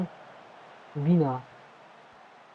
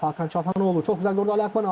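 normal talking voice

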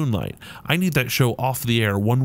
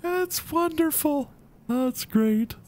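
squeaky mock delight; slower than usual. Gamer squeaking sarcastic praise at a gross handshake, high falsetto.

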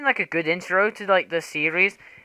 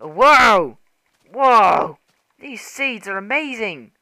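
loud, high amazed whoa; real wonder, high and loud; 5 semitones higher, 9 dB louder, much slower than usual. Real, not acted. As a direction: gasp out a loud whoa at the view. Amazed by the seed.

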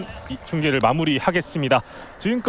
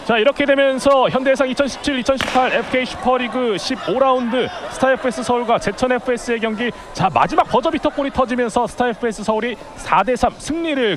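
excited sign-off; 6 semitones higher than usual. Real, not acted. Final result announcement.

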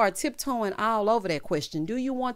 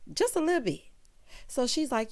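ordinary talk, nothing marked

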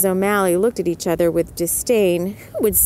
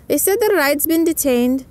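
Missus O'Malley voice; 8 semitones higher than usual. A narrator's reading. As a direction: lift the pitch way up and brighten. Missus O'Malley speaks to Mellie.

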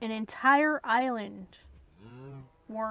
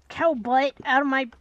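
yelling at cows; 11 dB louder than usual. Real, not acted.

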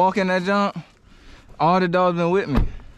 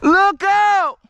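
shouting a warning; much slower than usual. Real, not acted. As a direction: call out sharply to warn someone. Calling warning out in the woods.

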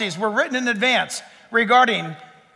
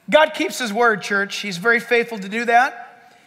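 ordinary talk

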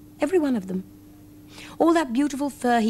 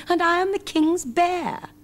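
higher, slow proud bear voice; proud teddy bear; 10 semitones higher than usual. A narrator's reading. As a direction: lift the voice like a proud little bear. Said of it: "said the king's teddy bear".

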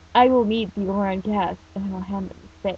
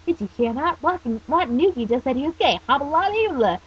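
higher, chattering gossip voice; excited gossip; 6 semitones higher, 4 dB louder than usual. Acted. Schoolmates spreading the news about the teen, excited gossip. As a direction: gossip eagerly, pitched up.

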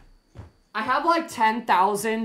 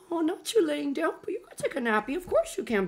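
cooing dog voice; 7 semitones higher than usual. Real, not acted. Owner allowing the dog a nappy, high coo. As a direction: pitch up and fuss over a sleepy dog.

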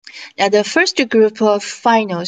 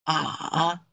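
"A" dipping low, then rising at the end; drawn-out "A" that sinks and turns back up; third tone; 4 semitones lower, 8 dB quieter than usual. Shown as a demonstration. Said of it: "imitate the tones".

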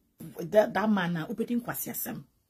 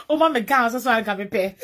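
words shouted through laughter, much louder; laughing; 2 semitones higher, 10 dB louder than usual. Real, not acted. Laughing at her own joke, loud through laughter. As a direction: laugh your way through the line.

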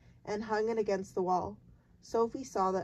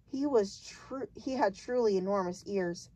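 ordinary delivery

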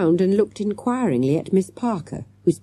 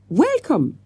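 higher, proud welcome with a French touch; 9 semitones higher than usual. A narrator's reading. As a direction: present it like a prized word. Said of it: "he added proudly".

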